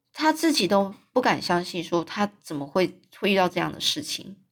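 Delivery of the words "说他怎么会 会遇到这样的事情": ordinary delivery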